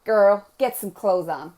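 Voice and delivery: put-on sassy American girlfriend accent; teasing American-accented scolding. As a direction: slip into a playful American accent. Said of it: "I always go to an American accent".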